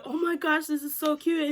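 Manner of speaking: gushing delight. Retold or imitated. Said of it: "I was like".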